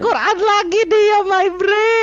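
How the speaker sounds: high-pitched, drawn-out mocking whine; mocking exclamation. Real, not acted. Debater ridiculing opponent's appeal to Quran, high mocking cry. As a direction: squeal it slowly in exasperated ridicule.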